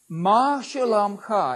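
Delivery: "Ma shalom cha" slow and drawn out; teaching a greeting. Shown as a demonstration. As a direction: say "Ma shalom cha" slowly, each word held for learners. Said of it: "I'll say it to you first".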